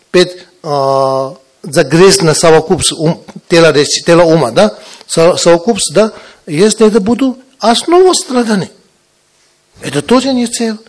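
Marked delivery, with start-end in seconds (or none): none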